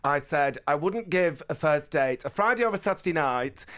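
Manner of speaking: ordinary talk, nothing marked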